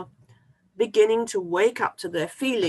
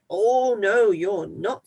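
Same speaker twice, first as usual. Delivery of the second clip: loud gruff "oh, no"; troll refusing; 3 semitones higher, 7 dB louder, slower than usual. Acted. Troll refusing the little goat, loud. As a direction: boom it out like a hungry troll.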